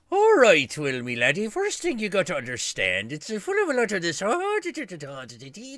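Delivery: high, loud hammed-up Irish accent; a deliberately awful stage Irish voice; terrible Irish accent. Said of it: "my really intentionally terrible Irish accent".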